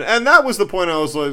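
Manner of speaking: ordinary talk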